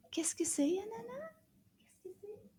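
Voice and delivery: high, tiny puppet voice; puppet question. Pretend. Puppet Anana asks the teacher what it is.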